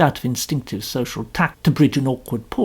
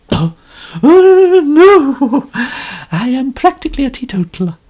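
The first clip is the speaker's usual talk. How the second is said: much higher, louder complaining lady; complaining novelist; 8 semitones higher, 7 dB louder than usual. A narrator's reading. Mrs Otterbourne boasting to Poirot, high and complaining. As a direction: preen and grumble at once.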